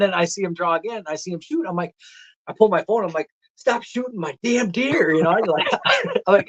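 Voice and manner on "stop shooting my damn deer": high, drawn-out mock yell; exasperated protest to a brother; retold exasperation. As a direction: plead down the phone, slow and high-pitched. Phoning brother to stop him shooting.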